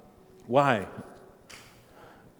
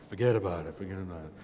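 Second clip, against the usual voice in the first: lightly voiced movie mobster; Godfather mobster; 3 semitones lower, faster than usual. Bishop as movie godfather, mock mobster dismissiveness. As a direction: toss it off with a hint of mob boss. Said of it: "almost like the movie The Godfather".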